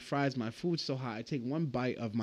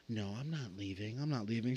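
soft, low, stubborn mock slur; mild imitation of a drunk refusing to leave; drunk refusal; 4 semitones lower, 9 dB quieter than usual. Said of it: "She goes".

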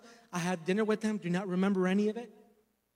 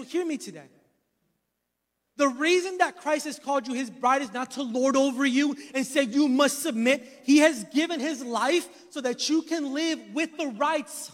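preaching; 3 semitones higher, 5 dB louder than usual. Acted. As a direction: preach it out with full force. Preaching at full force.

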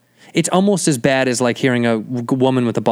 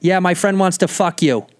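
put-on higher-pitched Boston girl; brash Boston accent; 5 semitones higher than usual. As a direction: brazen Boston-accent impression. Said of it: "Boston has this, like, just brashness".

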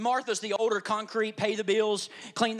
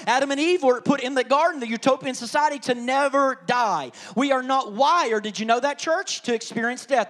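louder, driving sermon voice; fiery preaching; 3 semitones higher, 6 dB louder than usual. Acted. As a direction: preach it hard to the church. Preaching at full force.

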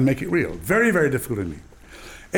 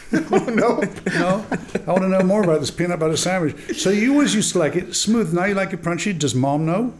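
mock interrogation; 6 semitones higher than usual. Voicing Nigel Hawthorne interrogating him.

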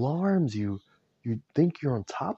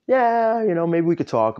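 acted agreement; 8 semitones higher, 9 dB louder than usual. Acts friend finally agreeing.

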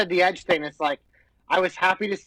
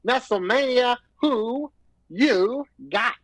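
higher, very slow hype delivery; drawn-out announcing; 4 semitones higher, much slower than usual. Pretend. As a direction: space the words out like a match announcer. Announcer-style question ending match preview.

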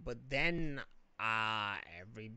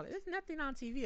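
high, whiny mimic of her complaint; mock whiny girl; 13 semitones higher, 3 dB quieter than usual. Mocking his girlfriend's complaint, high and whiny. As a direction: squeak it out like a bored girlfriend.

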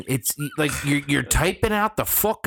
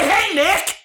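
emphatic example greeting; 4 dB louder than usual. Shown as a demonstration. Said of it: "So say".

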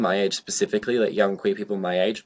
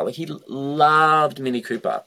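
ordinary delivery

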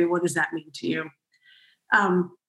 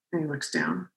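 dropped lower and much softer; looking down; 5 semitones lower, 8 dB quieter than usual. Retold or imitated. Telling the host about the shaman, dropping low and soft. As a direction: let the voice sink as his eyes go down.

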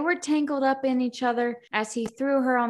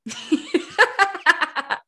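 high burst of loud laughter; cracking up at her own joke; 9 semitones higher, 6 dB louder than usual. Real, not acted.

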